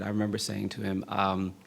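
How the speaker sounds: normal talking voice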